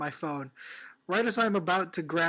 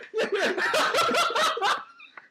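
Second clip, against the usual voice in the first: high laugh; 5 dB louder than usual. Real, not acted. Laughing at joke.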